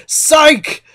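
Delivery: high, loud gotcha; mock the freaks with a sneer; taunting psych. Cronus betraying the freaks with a loud taunt. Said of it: "he goes".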